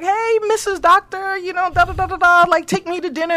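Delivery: high, gushing congratulations; all excited. Retold or imitated. Friend greets the supposed new bride that evening, all excited. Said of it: "I'm all excited".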